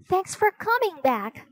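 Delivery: high, grateful exclamation; high-pitched girl character exclaiming; crying out. A narrator's reading. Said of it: "she cried".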